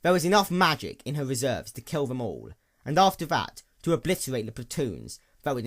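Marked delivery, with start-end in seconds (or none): none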